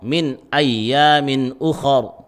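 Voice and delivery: "ukhar" with a thick, o-coloured "kha". Shown as a demonstration. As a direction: draw out "min ayyamin ukhar", darkening the kha. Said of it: "harus tebal".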